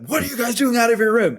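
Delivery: high, startled angry teacher shout; mock angry yell. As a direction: bark it like a teacher catching kids out. Angry chaperone catches students.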